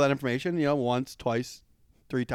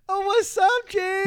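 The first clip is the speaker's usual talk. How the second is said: much higher, louder, exaggerated dude greeting; hammed-up bro voice; 5 dB louder, slower than usual. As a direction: overplay Carl's cheerful brush-off. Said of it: "And he's just like".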